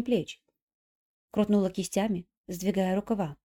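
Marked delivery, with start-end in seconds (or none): none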